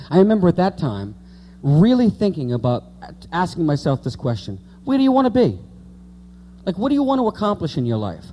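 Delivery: usual speaking voice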